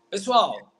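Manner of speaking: loud call, pessoal; calling out to viewers. Real, not acted. Host calling out to his Brazilian viewers, loud. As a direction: grab the audience's attention. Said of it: "addressing viewers in Portuguese".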